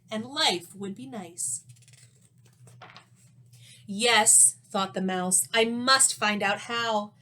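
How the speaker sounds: lively storybook read-aloud with rhyme; reading to children. A narrator's reading. Teacher reading a rhyming story to young pupils, animated. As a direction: read it like a picture book to the class.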